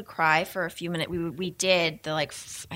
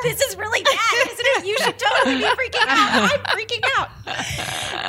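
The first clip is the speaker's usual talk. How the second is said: very high, loud, laughing mock panic; mock freaking out; 5 dB louder than usual. Parent panicking at crying baby, high laughing mock panic. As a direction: squeal it like a parent losing it. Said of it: "if you're there like".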